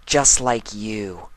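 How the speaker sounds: slow, drawn out words just like you; ominous pause. Acted. Twilight spooking the foals, slow and ominous. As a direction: let each word sink in. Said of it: "pausing for the last three words to sink in".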